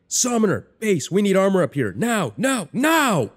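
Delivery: frantic high-pitched screamed demand; very high, loud, desperate yell; screaming into radio. A narrator's reading. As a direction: scream for backup, punching each word. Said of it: "Brenda screams into the radio".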